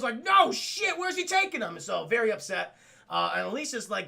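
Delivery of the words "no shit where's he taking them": high, loud startled cry; hammed-up panic; mock alarm. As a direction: shout after the fleeing enemy. Gargoyles alarmed, retold.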